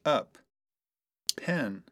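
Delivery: usual speaking voice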